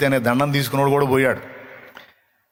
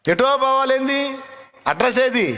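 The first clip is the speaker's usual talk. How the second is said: much higher, slow, incredulous asking; mock puzzled question; 10 semitones higher, slower than usual. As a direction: play the baffled listener, drawn out. Mocks people asking for address.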